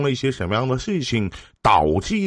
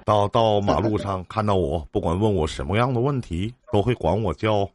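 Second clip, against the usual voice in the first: normal talking voice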